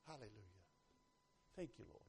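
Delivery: acting out barely daring to be heard; timid praise. Preacher mimicking someone who hopes nobody saw, timidly. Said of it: "Nobody saw".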